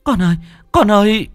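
high, loud desperate cry; frantic scream. A narrator's reading. Mother screams for her dead daughter, high loud cry. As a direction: scream for your lost child. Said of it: "kêu lên thất thanh".

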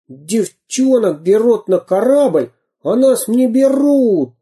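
высокий обиженный детский крик; пошуми, как обиженный мальчишка; шумящие мальчишки. Acted. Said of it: "шумели мальчишки". (мальчишки шумят, что их не берут на корабль)

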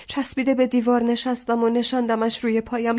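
plain reading voice

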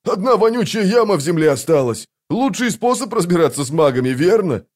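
high-pitched indignant justification; aggrieved, defensive voice; offended retort. A narrator's reading. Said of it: "обиженно огрызнулся Вал".

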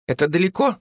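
put-on high child's voice asking; young girl, curious; little girl voice. A narrator's reading. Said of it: "спросила она".

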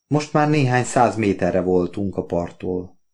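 ordinary delivery, nothing marked

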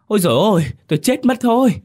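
screaming. A narrator's reading. Wife scalded by the shower, screaming in pain. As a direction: shriek as if scalded by hot water. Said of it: "khiến cô vợ hét toáng lên".

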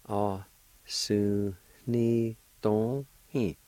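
slow, drawn-out teaching pace; slow and careful; very slow demo. Shown as a demonstration. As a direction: stretch every syllable out for the learner. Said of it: "It's pronounced like this".

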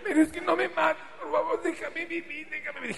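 high falsetto pleading, fading; squeaky begging. Acted. Enedina begging her attacker to let her live, high and fading. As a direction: beg him for your life in a squeak.

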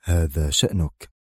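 the man unbothered; utmost calm. A narrator's reading. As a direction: shrug it off with total calm. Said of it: "بمنتهى الهدوء".

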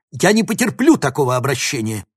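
loud, indignant outburst; boiling with anger. A narrator's reading. Professor offended by his student, loudly indignant. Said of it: "вскипел Клебин".

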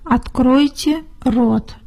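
ordinary talk

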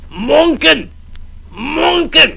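high loud call at the door; drunken shouting. Acted. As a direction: bang and yell his name.

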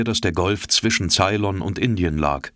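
ordinary delivery, nothing marked

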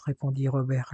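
plain reading voice

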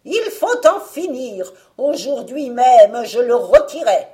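loud, high witch outcry; witch exclaiming. Acted. Witch tired of waiting for Jean to fatten, loud exclamation. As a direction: cry out with cruel impatience. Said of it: "elle s'écria".

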